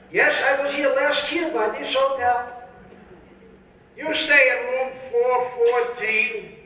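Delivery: put-on slow high old man. Epstein and stranger on dates.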